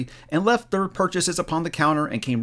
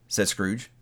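plain reading voice

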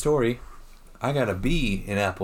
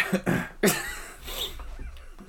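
sneezing; 9 semitones higher, 4 dB quieter than usual. Real, not acted. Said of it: "just sneezed like an old prospector".

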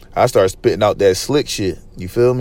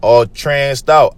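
ordinary delivery

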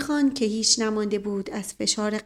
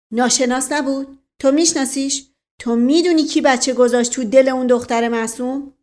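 loud, higher menacing challenge; threatening; 3 semitones higher, 8 dB louder than usual. A narrator's reading. Dustali Khan confronts Aspiran from half out of his chair, threatening. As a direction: fire accusing questions as a threat. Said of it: "با حالت تهدید".

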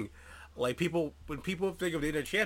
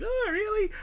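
high mock-incredulous squeak; skeptical oh really. Doubters scoffing at his champion pick, mock disbelief. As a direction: pitch it way up in fake disbelief. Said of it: "people might be like".

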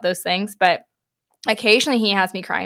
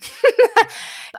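self-conscious laugh; 4 dB louder than usual. Real, not acted. Laughs at being so emotional.

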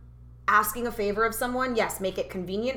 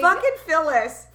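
exasperated; 6 semitones higher, 6 dB louder than usual. Pretend. Mock exasperated name-calling.

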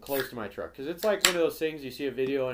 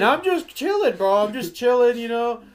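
high, loud insistence on innocence; exasperated protest; 9 semitones higher, 10 dB louder than usual. Retold or imitated. Driver blamed for passenger's honking, high exasperated protest. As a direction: plead your case in a raised voice.

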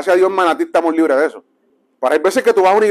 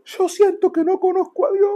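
imitating a weeping old preacher; tearful confession; 11 semitones higher, 5 dB quieter than usual. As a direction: confess it as if through tears. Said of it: "él comenzó a llorar y me dijo".

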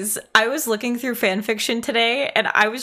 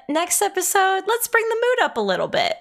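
upbeat quoted suggestion; 11 semitones higher than usual. Retold or imitated. Quoting their own plan.

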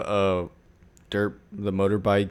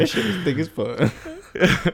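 laughing; 8 semitones higher, slower than usual. Real, not acted. Joking about the thick book.

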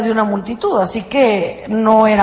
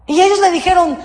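ordinary talk, nothing marked

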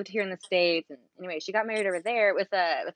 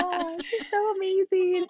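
gushing; 11 semitones higher, 4 dB louder than usual. Retold or imitated. Her own gushing quote, posting online.

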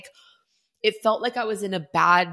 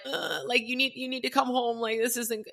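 upset plea; 7 semitones higher, 5 dB quieter than usual. Retold or imitated. Calling husband after cancer news.

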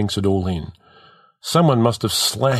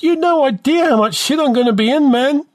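high, strained-sounding panicky complaint; dismayed kid; 4 dB louder than usual. A narrator's reading. Dismayed kid fears big trouble.

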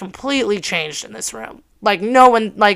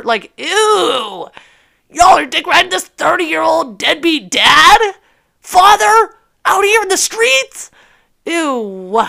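high, loud scornful disbelief; disgusted mockery; 7 semitones higher, 3 dB louder, slower than usual. Mocking the guys who back the deadbeat dad, disgusted. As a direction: sneer at them in rising disgust.

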